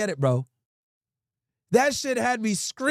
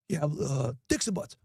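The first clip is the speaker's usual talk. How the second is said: low, hushed sleazy caller; pervy voice; 6 semitones lower, 7 dB quieter, slower than usual. Retold or imitated. Prankster gives the clerk a fake title, low and sleazy. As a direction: murmur the title like a creep. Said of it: "in a pervy voice".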